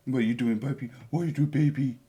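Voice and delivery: soft cooing pet voice. Real, not acted. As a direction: coo quietly to your dog. Talking to his dog.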